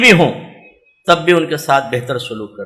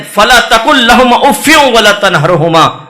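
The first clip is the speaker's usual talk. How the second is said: Quranic recitation; 4 semitones higher, 5 dB louder than usual. Acted. Quoting Quran verse.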